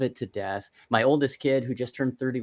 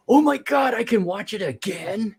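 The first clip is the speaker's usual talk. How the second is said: high, thrilled squeal of delight; overjoyed kid discovering the tape; excited; 9 semitones higher than usual. Retold or imitated. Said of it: "And I go".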